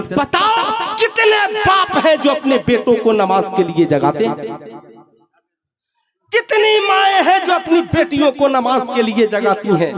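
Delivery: high, challenging sermon cry; ringing questions that start high and descend; full-force preaching. Acted. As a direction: challenge the congregation from a high pitch.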